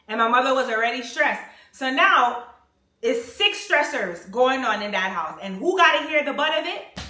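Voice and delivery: building rant. Real, not acted. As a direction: push harder with each new stressor.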